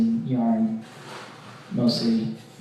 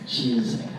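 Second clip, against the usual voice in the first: normal talking voice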